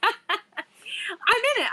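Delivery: real laugh through the words. Real, not acted. Laughing at being in her friend's picture.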